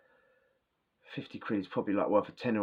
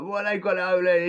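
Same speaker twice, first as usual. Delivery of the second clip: higher, louder surprised question; surprised mate; 9 semitones higher, 6 dB louder than usual. Retold or imitated. Mate surprised it's over there, higher and louder. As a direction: mimic the mate's startled ask. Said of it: "He's like".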